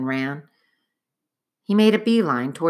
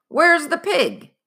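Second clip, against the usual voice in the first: high, slow, loud demand; angry demand; 11 semitones higher, 4 dB louder, slower than usual. Acted. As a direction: bark for the missing pig. Cook demanding the pig.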